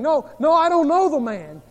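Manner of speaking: panicked denial. Acted. Peter denying he knows Jesus, loud and frantic. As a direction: Peter blurting it out in fear.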